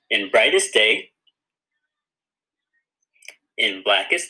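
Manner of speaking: measured oath with long gaps left for repeating; slow recitation. Shown as a demonstration. Teacher leading kids through the oath to repeat, slow recitation. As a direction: recite it slowly, phrase by phrase. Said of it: "I'm gonna say it slowly".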